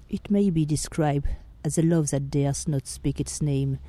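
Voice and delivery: soft, measured reading of a famous speech; solemn recitation. Acted. Podcaster reads Oscar Wilde's defence to listeners, solemn and hushed. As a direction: read it like a courtroom plea, hushed and grave.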